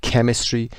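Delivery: "Chemistry" opening on a K sound. Shown as a demonstration. Teacher repeating the subject for learners, "Chemistry" with a K. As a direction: pronounce the CH of "Chemistry" as K.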